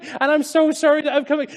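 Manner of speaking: high, loud, urgent confession; apologizing son. Acted. Son trying to apologize to his excited father, urgent. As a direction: blurt out an acted apology. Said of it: "you can imagine the son saying it".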